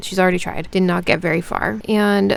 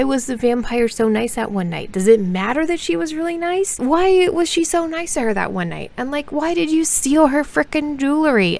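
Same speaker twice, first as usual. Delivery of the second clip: exasperated rant; 7 semitones higher than usual. Acted. Ranting about plot holes.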